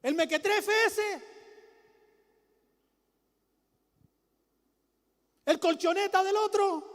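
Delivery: high, loud sneering jab; preacher mocking his opponents, scornful and loud. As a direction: sneer at the nobody who opposes you. Scorning enemies in sermon.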